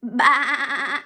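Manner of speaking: loud bleating baa like a sheep. Shown as a demonstration. Teacher asks kids to baa, then bleats loudly. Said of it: "Can you baa like a sheep".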